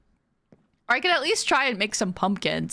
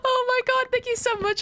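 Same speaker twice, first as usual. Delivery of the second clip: much higher, squealing thanks; excited thanks. Real, not acted. Streamer thanks a viewer for a gifted sub, squealing with excitement. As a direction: gush over the gift.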